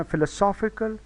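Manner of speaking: Philosophical said fil-uh-SOF-i-kul; philosophical with weight on its middle syllable; stress on third syllable. Shown as a demonstration. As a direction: punch the सॉ in philosophical. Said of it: "तीसरा syllable stress है".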